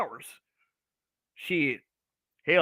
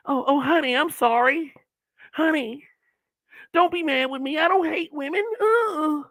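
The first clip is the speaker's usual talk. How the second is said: higher, simpering voice begging honey not to be mad; mock pleading husband; 8 semitones higher than usual. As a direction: play a whipped husband grovelling, pitched up. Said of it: "guys pleading with their wives".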